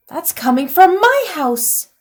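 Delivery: high, loud excited exclamation; excited recognition. Acted. Troll realizing the smoke is from his house. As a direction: burst out with joyful recognition. Rollo smells smoke from home.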